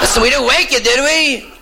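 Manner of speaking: high-pitched put-on caller; caller checking in. Caller asks whether they woke him.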